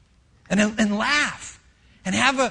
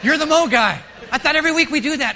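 put-on kids complaining; 2 semitones higher, 3 dB louder, faster than usual. Kid asks Dad why no mowing today, shouting and laughing. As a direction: pile on the complaints like a confused child. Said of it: "Really make them weird".

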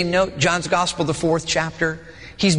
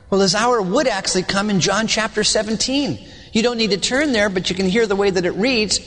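ordinary talk

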